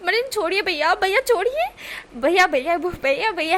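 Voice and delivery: high, loud yelled pleas; yelling. Acted. Wing dragged away, loud hammed-up yelling. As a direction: keep shouting bhaiya at them. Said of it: "wing चिल्ला रहा था".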